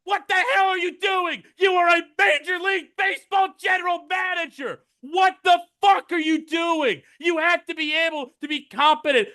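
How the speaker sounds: mock-furious fan rant; exasperated yell. Real, not acted. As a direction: blast him in disbelief.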